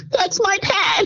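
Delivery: a shocked cry of dismay; horrified cry. Retold or imitated. Cook spotting her own pad on the floor, horrified cry.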